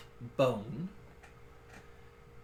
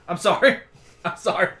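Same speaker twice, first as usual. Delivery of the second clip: loud, high frantic apology; screaming pawn; 5 semitones higher, 9 dB louder, faster than usual. Acted. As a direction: yelp it out in fright. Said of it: "the pawn just scream".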